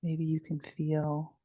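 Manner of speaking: soft, unhurried meditation voice with long pauses; guided meditation. Real, not acted. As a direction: guide them gently through each breath, hushed and spacious. Leads the body scan meditation.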